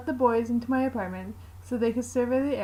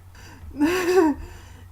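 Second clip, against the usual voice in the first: bright short laugh; amused laugh; 8 semitones higher, 5 dB louder than usual. Acted. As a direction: let out a quick laugh. Laugh after rolling her eyes.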